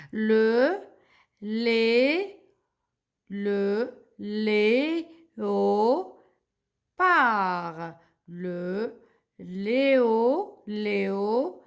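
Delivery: very slow syllables building up "léopard"; teacher sounding out, long pauses between syllables; silent final D. Shown as a demonstration. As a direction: stretch "léopard" as lé... o... paar, no D. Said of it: "Le D, je le prononce pas".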